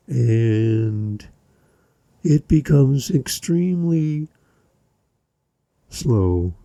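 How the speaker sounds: slowed-down, drawn-out words; slow speech. Shown as a demonstration. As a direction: drag each word out slowly. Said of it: "they slow down their speech".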